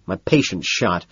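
usual speaking voice

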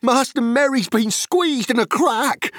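high urgent call; alarmed cry; cried out. Acted. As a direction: blurt it out in alarm. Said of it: "cried Sam".

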